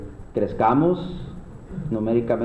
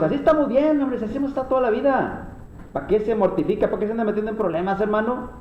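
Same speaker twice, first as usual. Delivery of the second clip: much higher, quicker voice of an objecting congregant; high, rapid mock objection; complaining church member; 8 semitones higher, faster than usual. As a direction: mimic a member protesting the change in a high-pitched, hurried voice.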